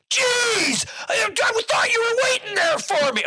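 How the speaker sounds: high, loud exasperated jeez; dad's booming would-be whisper; mock loud whisper. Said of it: "In his whispering voice".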